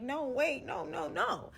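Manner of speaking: high, frantic pleading; retold panic as she's grabbed from behind; panicked protest. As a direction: beg them to stop, rising in panic. Being snatched away in a standoff.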